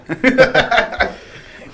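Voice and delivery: a real laugh at his own joke; high breathy laugh. Real, not acted.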